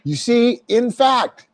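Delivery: preacher's emphatic insistence; emphatic punch. Shown as a demonstration. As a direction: hit "see" and "fact" hard to drive it home. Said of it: "punch it a couple times".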